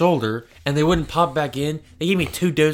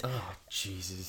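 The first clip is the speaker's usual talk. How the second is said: breathy whispered dismay; 6 semitones lower, 18 dB quieter, slower than usual. Real, not acted. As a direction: whisper it in disgust. Reacting to ants in cake.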